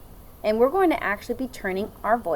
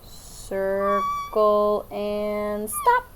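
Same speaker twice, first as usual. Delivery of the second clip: drawn-out, slow circle and stop; slow teacher demo; much slower than usual. Shown as a demonstration. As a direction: stretch it out as you draw. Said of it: "So it's gonna look like this".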